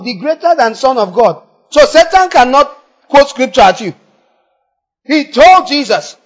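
loud, high, driving sermon; fiery preaching. Acted. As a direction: thunder at the congregation. Preaching at full force.

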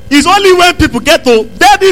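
ordinary talk

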